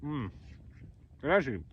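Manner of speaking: talking through a mouthful; mouth full. Real, not acted. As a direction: say it while still chewing. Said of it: "I don't wanna talk with my mouth full".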